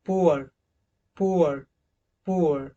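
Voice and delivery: पुअर said carefully with the उआ glide; model word. Shown as a demonstration. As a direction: stretch the उआ in पुअर. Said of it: "उआ का sound".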